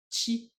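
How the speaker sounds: "chi." said as a crisp Japanese chi, pitch up; a clear chi for the class. Shown as a demonstration. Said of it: "que les japonais prononcent bien chi".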